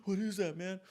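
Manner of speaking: choked-up, gasping mimicry; gasping for air. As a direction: act out struggling for breath. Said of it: "gasping for air".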